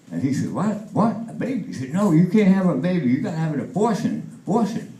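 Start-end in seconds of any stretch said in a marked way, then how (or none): 0.5-1.6 high-pitched startled questions; shocked disbelief. Acted. GI hears his girlfriend is pregnant, shocked. 1.9-4.9 panicked insistence. Acted. GI argues against the baby.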